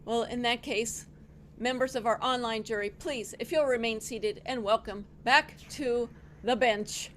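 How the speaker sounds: ordinary talk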